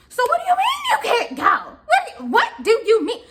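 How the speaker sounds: high-pitched, incredulous demand; aggravated. Retold or imitated. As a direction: act out exasperation, pitch leaping up. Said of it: "when you get aggravated".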